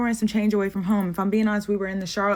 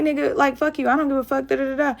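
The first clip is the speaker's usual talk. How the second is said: higher-pitched defiant comeback; retold arguing; 6 semitones higher, 4 dB louder than usual. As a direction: throw it back at him, raised pitch. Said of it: "we get into an argument".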